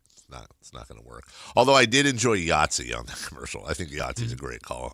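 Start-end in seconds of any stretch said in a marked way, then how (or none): 0.1-1.2 quiet. Retold or imitated. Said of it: "You're like".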